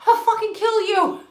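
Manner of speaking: high, loud mock threat; hammed-up angry mom yell; mock furious yell. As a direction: shriek it like a mom chasing her kid. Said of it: "I chased my boys down the street".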